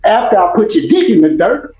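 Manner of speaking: usual speaking voice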